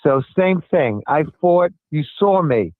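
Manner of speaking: ordinary talk, nothing marked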